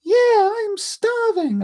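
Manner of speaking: very high put-on child voice; badly faked squeaky little girl. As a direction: do a strained falsetto little girl. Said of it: "I cannot do a little girl voice".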